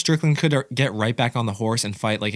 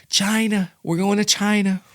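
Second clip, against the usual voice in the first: excited hype; 10 semitones higher than usual. Retold or imitated. Fan cheering the fight heading to China, high and hyped.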